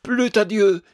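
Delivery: high, loud declamation; stagey, self-important exclamation; affectedly distinguished. A narrator's reading. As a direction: intone it with would-be grandeur. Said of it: "avec un accent qu'il voulait distinguer".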